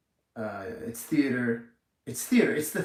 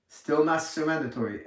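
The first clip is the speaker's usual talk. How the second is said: ordinary talk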